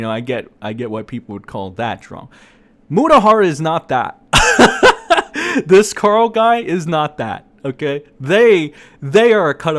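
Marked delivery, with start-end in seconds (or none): 4.3-5.6 loud, high burst of laughter; laughing. Real, not acted. Host scoffing at the drama label, laughing loudly. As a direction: crack up mid-argument.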